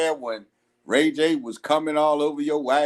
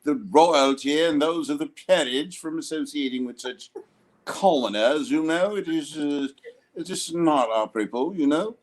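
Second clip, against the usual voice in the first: put-on British accent; 2 semitones lower than usual. Host on request, mock British aristocrat. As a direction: lay on a stuffy British aristocrat. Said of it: "could you do the accent, please, just once?".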